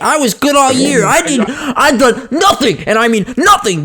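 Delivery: high, outraged acted outburst; hammed-up righteous indignation; indignant protest. Protests being put on naughty list.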